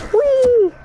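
high gleeful squeal going down; gleeful whee. Real, not acted. Player squeals while dropping down, gleeful whee. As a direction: let it fly like a slide ride.

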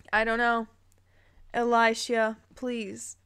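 ordinary talk, nothing marked

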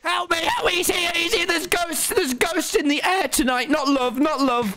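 high-pitched frantic cries; hammed-up panic. Player shrieking to friend about ghosts, high mock panic. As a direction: cry for help in mock terror.

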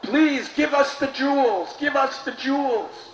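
high, pleading crowd cry. Acted. Crowd crying out to Ramanuja, pleading. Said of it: "the people were crying out".

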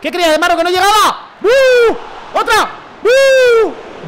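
loud, high-pitched gamer crowing; excited goal shouting. Real, not acted. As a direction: yell it out, stretching the cheers. Gol y celebración del videojuego. (gritos eufóricos)

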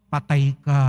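low, heavy "patay ka"; ominous punchline. Acted. Priest warns shoppers at the checkout, low ominous punchline.

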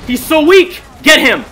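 high, loud command; frantic battle holler; urgent shout. Real, not acted. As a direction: yell at teammates to pounce. Urging team to attack.

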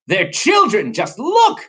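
high storybook exclamation, climbing; hammed-up indignation; outraged protest. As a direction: protest with rising pitch. Waffle protests to the professor.

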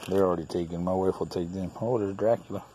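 ordinary talk

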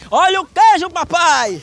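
high, loud vendor cry; singsong beach vendor call. Pretend. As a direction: belt it out like a street seller. Prank cheese seller calling out.

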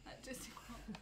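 whispering. Real, not acted. Whispered echo of a place.

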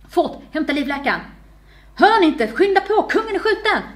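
high, loud barked orders; frantic emergency commands; urgent shouting. A narrator's reading. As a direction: call for help in a panic, climbing in pitch. Man shouts orders after the shooting.

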